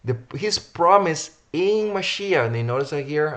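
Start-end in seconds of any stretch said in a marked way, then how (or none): 0.3-2.5 "promise in" said high and loud, "in" drawn out; stress on PROMISE IN. Real, not acted. Preacher points listeners to the verse, stressing "promise in". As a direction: lean hard on "promise" and stretch "in". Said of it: "I wanna emphasize".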